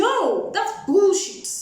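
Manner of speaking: loud, high, drawn-out no; slow, emphatic scornful dismissal; indignant rejection. Retold or imitated. Woman rejecting friend's excuse, indignant rejection.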